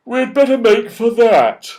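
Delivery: father bear. A narrator's reading. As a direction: urge the others on like a big bear. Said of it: "said the father bear".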